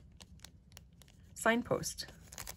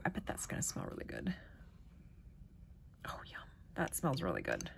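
whisper; 13 semitones lower, 11 dB quieter, faster than usual. Real, not acted. Smelling balm, whispering.

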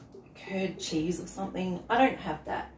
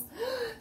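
high gasp; 14 semitones higher, 6 dB quieter than usual. Acted. Mock gasp at nails.

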